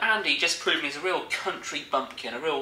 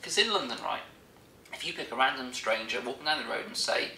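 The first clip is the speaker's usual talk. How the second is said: ordinary talk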